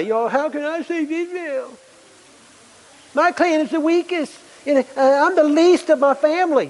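high, pleading voice full of excuses; whining Gideon. Acted. Gideon whines excuses to God in a high voice. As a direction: plead as the weakest man in the weakest clan. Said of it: "he begins to say".

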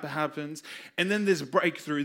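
ordinary talk, nothing marked

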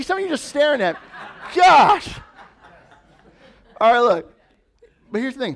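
normal talking voice

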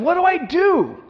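high, loud pleading question; Paul crying out for direction; urgent question. Acted. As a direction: beg for orders, eager and overwhelmed. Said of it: "he asks the next great question".

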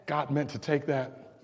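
low, soft, measured scripture reading. Acted. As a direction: read the verse solemnly, with deliberate pauses. Reads scripture aloud to congregation.

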